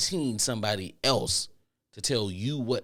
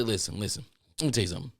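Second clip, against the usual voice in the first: ordinary talk, nothing marked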